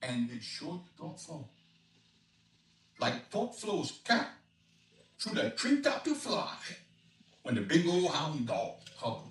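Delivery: rollicking Cajun rhyme, rising in pitch; Cajun dialect reading. Acted. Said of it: "an expert on Cajun dialect".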